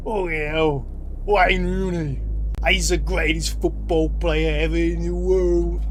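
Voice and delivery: put-on slow, loud working-class English accent; rough English accent. A local praising Wayne Rooney, in a loud working-class English accent. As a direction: drawl it out like a football-mad local. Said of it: "And then you just hear".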